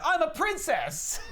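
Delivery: put-on high, slow, drawn-out girlish squeal; delighted little girl. Girl in new lipstick announces royalty, squealing delight. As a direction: proclaim it like a kid playing dress-up.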